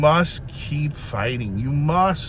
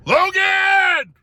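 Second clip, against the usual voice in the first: high, loud shout of the name; calling out; 6 dB louder than usual. Real, not acted. Speaker calls out to Logan in the audience, loud high shout. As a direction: call him out across the room.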